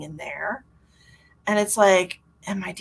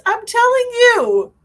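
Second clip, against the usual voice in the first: loud, high and slow, leaning on telling; drawn-out loud plea, stress on telling; emphatic insistence; 11 dB louder, slower than usual. Real, not acted. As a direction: hammer the point home, pitched way up. Insisting how hard crying is.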